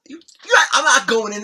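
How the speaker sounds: mock-terrified, high-pitched refusal; petrified protest. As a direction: act out digging your heels in, scared. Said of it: "I was petrified".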